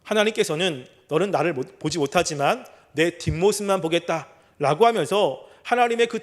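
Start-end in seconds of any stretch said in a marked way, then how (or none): none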